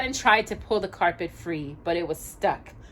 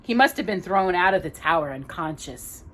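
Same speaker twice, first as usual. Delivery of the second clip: usual speaking voice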